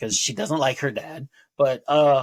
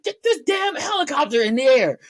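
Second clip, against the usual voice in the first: high-pitched yell, louder; impatient shout; 13 semitones higher, 4 dB louder than usual. Retold or imitated. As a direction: bark the order in a much higher voice. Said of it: "He's like".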